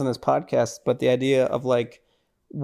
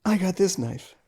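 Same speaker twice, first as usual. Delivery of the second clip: tough guy quote; 5 semitones higher, 4 dB quieter than usual. Retold or imitated. Podcaster repeating Big John's line, amused mock tough. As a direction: repeat the mock boast, a bit softer. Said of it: "he says".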